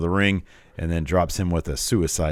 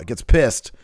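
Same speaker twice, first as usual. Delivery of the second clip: normal talking voice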